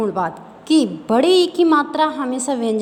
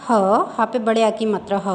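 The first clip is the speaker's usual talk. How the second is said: usual speaking voice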